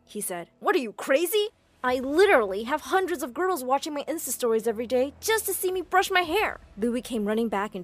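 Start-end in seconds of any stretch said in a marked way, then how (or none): 0.6-6.6 high, loud affronted boasting; indignant vanity. Acted. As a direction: brag as if deeply insulted. Vain guy offended at being screamed at.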